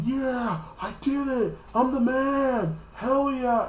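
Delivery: high, gloating hammed-up brag; hammed-up cocky victory cry; cocky boast. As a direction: crow about your win. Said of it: "kinda be cocky".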